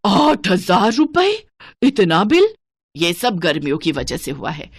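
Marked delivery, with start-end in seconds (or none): 0.0-2.5 higher, slow, loud cry of disbelief; stunned by the electricity bill; gasping at the huge bill; shocked disbelief. Acted. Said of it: "घबरा जाती है".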